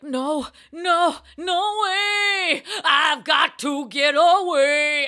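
high, loud panicked yelling; hammed-up fleeing rock star; mock panicked shout. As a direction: shout it like you're fleeing a fan. [name] fleeing a fan.

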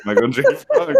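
high goofy voice with a laugh; Michael J Frog voice. Pretend. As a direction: squeak it like a cartoon frog. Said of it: "In the voice of Michael J Frog".

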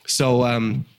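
ordinary talk, nothing marked

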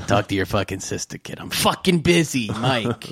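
put-on a gruff, irritated father shooing his kid off; dad snapping at his son to leave him alone; gruff annoyed dad. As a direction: swat the kid away like a busy, grumpy dad. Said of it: "my dad was like".

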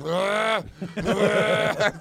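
high, loud strangled "ah, ah"; stunned gasp. Retold or imitated. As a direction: choke out a wounded "ah". His stunned reaction to rejection.

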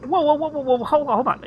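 high, urgent stopping; alarmed. Real, not acted. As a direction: jump in to stop them.

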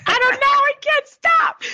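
very high, drawn-out mock wail; retold helpless wail. Host retelling her answer to sleepy boyfriend, high mock wail. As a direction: whine it sky-high, stretching the last words.

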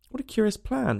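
high, curious exclamation; exclaimed. A narrator's reading. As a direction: marvel at the odd idea. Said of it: "exclaimed Alice".

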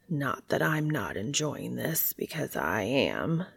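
low, soft husky voice. A narrator's reading. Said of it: "Cass says in a husky voice".

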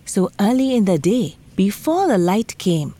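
usual speaking voice

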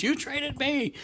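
high-pitched mock indignation; indignant protest. Player retorting to a heckling fan, indignant retold protest.

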